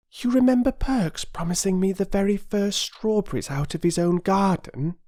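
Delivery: put-on high, eager girl's voice; a young girl recalling a promise; eager little girl Phyllis. Phyllis to the others, an eager high girl's voice.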